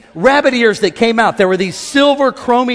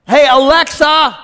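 raised, slowed call; louder call; 5 semitones higher, 6 dB louder, slower than usual. Retold or imitated. Ashton calling Alexa louder over music. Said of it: "The music's too loud".

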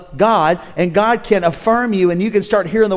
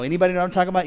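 normal talking voice

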